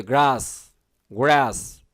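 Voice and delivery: গ্রাস with British open "ah", "graas"; British pronunciation. Shown as a demonstration. Teacher demonstrates for students, British pronunciation of গ্রাস. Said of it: "British উচ্চারণ হলে কি হতো?".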